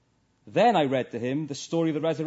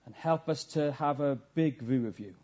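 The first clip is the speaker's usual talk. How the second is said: earnest prayer, a little lower in pitch; closing prayer; 3 semitones lower than usual. Real, not acted. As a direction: pray it humbly on behalf of the congregation.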